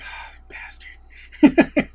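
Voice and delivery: grumbling Bronn. Retold or imitated. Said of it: "Bronn's like".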